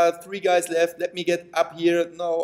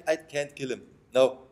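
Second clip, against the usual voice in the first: ordinary talk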